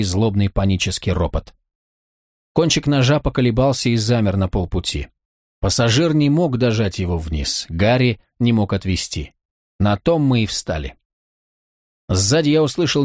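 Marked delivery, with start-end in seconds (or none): none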